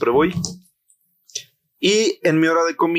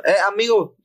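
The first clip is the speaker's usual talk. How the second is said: "Eh, amigo" sung out higher, dwelling on "amigo"; hamming up the stranger's singsong hail; imitating the stranger's tone; 7 semitones higher than usual. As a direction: copy his exact calling tone, higher. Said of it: "Así, así con el tono".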